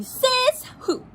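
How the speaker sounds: high, indignant cry; angry frog. Acted. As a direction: fire it back defiantly.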